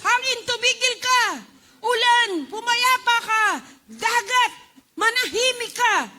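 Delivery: loud, high commands; Jesus commanding the storm to stop. Acted. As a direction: order the wind and sea to be still. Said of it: "Jesus rebuked the storm".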